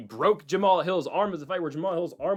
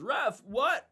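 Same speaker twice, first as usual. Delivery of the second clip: higher, mock-indignant "Ref, what?"; mock indignant ref appeal; 9 semitones higher than usual. Fighter protesting to the referee, high mock indignation. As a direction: act out a fighter's put-on protest.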